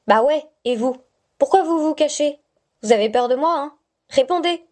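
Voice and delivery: put-on defiant little girl. Stella taunting the unseen voice, defiant childlike tone. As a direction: dare the voice to show itself.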